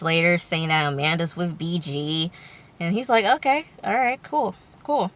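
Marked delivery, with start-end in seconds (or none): 3.2-5.1 higher-pitched, softer retold okay; casual acceptance. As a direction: imitate someone lightly going along with it. Acting out his casual reply.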